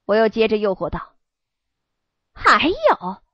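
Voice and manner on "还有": eager, rising question; ask, hungry for more; excited question. Acted. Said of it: "小犬春浪激动地问".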